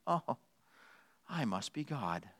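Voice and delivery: very soft, low self-satisfied realization; lightly voiced cat, quietly smug; self-important cat. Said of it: "Cat says the exact same thing".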